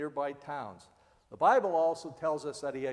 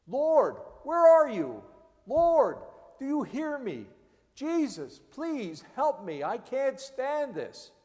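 higher, louder desperate plea; crying out in fear; 8 semitones higher, 7 dB louder, slower than usual. Pretend. A believer in crisis, desperate fearful plea to God. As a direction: beg for help at your wits' end. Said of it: "do we cry out in fear".

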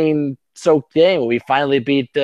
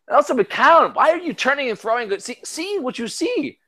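exasperated at the quarterback; 11 semitones higher than usual. Real, not acted. Yelling at quarterback on TV.

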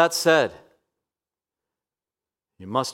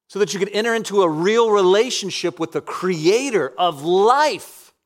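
rising, louder impassioned preaching; preaching at full force; 6 semitones higher, 4 dB louder than usual. Acted. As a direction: build it to a ringing climax. Preaching climax, rising intensity.

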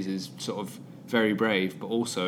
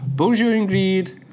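slow, higher, louder schoolbook French; schoolboy French accent; 9 semitones higher, 5 dB louder than usual. Pretend. Introducing himself to Ingrid in hammed-up schoolboy French. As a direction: overdo the French-class accent. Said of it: "tell her what village you're from in, in French".

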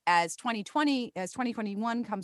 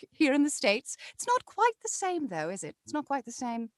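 improvised character voice; 3 semitones higher, 4 dB louder than usual. Acted. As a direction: play a chatty British expat in character. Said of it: "I love all those characters and voices".

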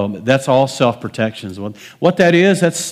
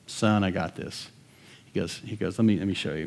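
calm dad; 4 semitones lower, 10 dB quieter than usual. Retold or imitated.

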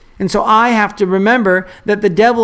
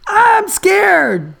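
high, imitated little-kid wail; scared child's yell; 12 semitones higher than usual. Young son in bed to his dad, high imitated scared yell. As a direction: whine it out high like a frightened boy. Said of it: "he yelled".

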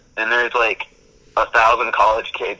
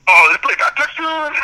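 very high, loud, raspy holler; squeezed raspy shout, hammed up; raspy party DJ; 7 dB louder than usual. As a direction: imitate a hyped-up spring break DJ. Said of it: "he had, like, such a raspy voice".

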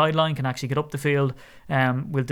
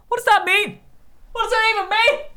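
squeaky, baffled high voice; high mock confusion; incredulous. Baffled by a catchphrase.

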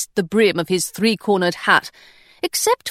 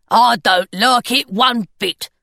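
slow, grumbling gargoyle voice; a grumpy little stone gargoyle agreeing; slow gargoyle grumble. Acted. Gargoyle speaking.

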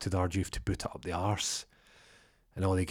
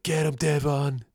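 higher, louder egging-on shout; crowd cheer; 7 semitones higher, 5 dB louder than usual. Retold or imitated. Gang egging Devon into the fight, shouting.

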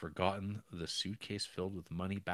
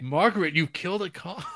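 high, loud mock shock; hammed-up horror at a killing; shocked outcry; 10 semitones higher, 9 dB louder than usual. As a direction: cry it out in disbelief. Shocked accusation retold.

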